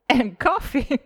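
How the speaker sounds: tickled listing with a chuckle; laughing. Real, not acted. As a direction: laugh between the perks. Laughing about cafe perks.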